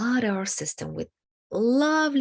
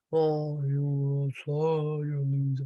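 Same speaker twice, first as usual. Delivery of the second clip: slowed-down, lower inner critic; 5 semitones lower, slower than usual. Shown as a demonstration. Teacher slowing the critical inner voice. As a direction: stretch the insult out slowly. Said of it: "or make it very slow".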